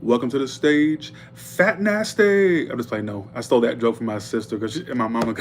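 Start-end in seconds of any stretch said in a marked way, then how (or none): 0.0-2.7 high, drawn-out announcer hype; club announcer call. Retold or imitated. Announcer hyping the dancer onto the stage. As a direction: stretch the name out like a strip club MC. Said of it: "the announcer would go".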